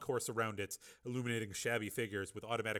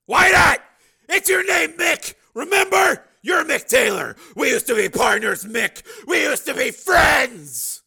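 very high, loud appeal; pleading shout; 20 dB louder, slower than usual. A narrator's reading. Steel appealing to his old partner, shouting. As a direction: plead across the distance.

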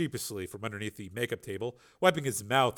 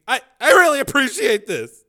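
nervous thanks; 14 semitones higher, 13 dB louder than usual. Acted. Tracy stammering thanks.

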